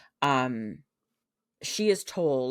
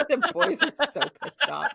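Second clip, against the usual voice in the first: higher, louder, laughing through it; 13 semitones higher, 6 dB louder than usual. Real, not acted. Laughing to the co-host about the angry princes, loud laughter. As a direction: crack up while saying it.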